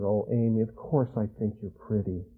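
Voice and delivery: low, very soft tender reassurance; reassuring father. Retold or imitated. Father reassuring daughter she is pretty, soft and low. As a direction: comfort her gently and low.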